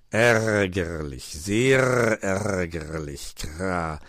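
ponderous, displeased bird; annoyed raven. A narrator's reading. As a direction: draw each word out and caw. Raven voices his annoyance.

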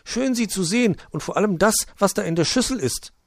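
high chirpy little bird. Acted. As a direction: chirp a greeting. Bird eyes the fruit bowl.

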